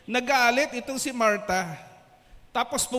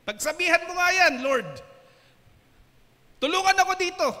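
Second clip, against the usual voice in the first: loud demand; 7 semitones higher, 6 dB louder, faster than usual. Acted. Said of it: "Sabi niya".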